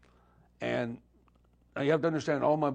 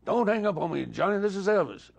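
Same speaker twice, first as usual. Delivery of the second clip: higher and louder, pleading not to be hung up on; 8 semitones higher, 6 dB louder than usual. Retold or imitated. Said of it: "that was an awfully good impersonation".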